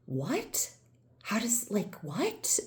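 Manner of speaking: softly stunned, voice lifting on each question; baffled disbelief. Retold or imitated. Patient amazed by a stranger's healing, quietly baffled.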